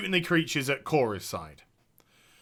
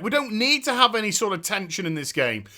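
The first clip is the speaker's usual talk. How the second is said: ordinary talk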